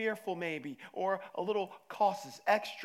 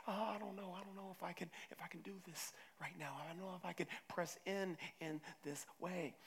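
soft, near-whispered anxious doubting; hushed, hesitant inner fear; fearful doubt; 15 dB quieter than usual. Retold or imitated. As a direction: murmur the doubts of a frightened believer.

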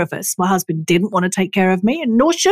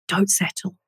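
soft inner voice; 7 dB quieter than usual. Retold or imitated. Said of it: "And it was just like".

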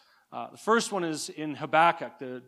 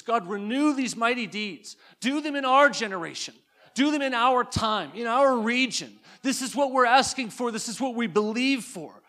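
higher, louder pleading cry; impassioned prayer; 4 semitones higher, 4 dB louder than usual. Acted. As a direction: cry out for the whole region. Voices the cry of revival.

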